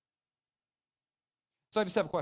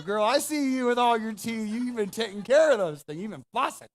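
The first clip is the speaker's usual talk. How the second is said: lightly put-on Southern admirer, louder and higher; Alabama flirt; 6 semitones higher, 7 dB louder than usual. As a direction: ham up an Alabama charmer, loud and high. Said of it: "I used to live in Alabama".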